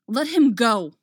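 loud desperate shout. A narrator's reading. Obi-Wan shouts at Dooku to free Anakin, desperate. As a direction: yell it to save him.

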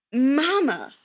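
loud, exasperated interjection; cutting in. A narrator's reading. Son stopping his mother mid-ramble, cutting in. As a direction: cut her off loudly. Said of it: "Chris cuts in".

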